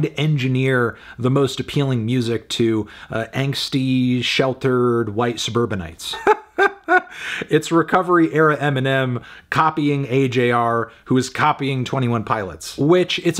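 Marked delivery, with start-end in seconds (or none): 6.2-7.4 short high-pitched staccato laugh; a quick burst of high chuckles. Real, not acted. Laughs at his own jab.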